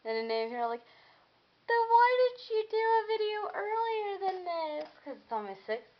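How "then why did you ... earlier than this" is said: high, whiny imitation of parents; mock parent question. Vlogger mimicking her parents asking why. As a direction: squeak it out like a nagging parent.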